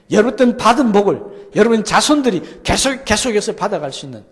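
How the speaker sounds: loud, high, ringing climax of the sermon; fervent preaching. Real, not acted. Pastor crying out God's promise to the congregation, loud and high. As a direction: proclaim it at full voice to the whole room.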